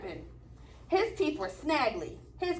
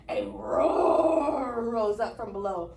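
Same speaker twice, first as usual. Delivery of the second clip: ordinary delivery, nothing marked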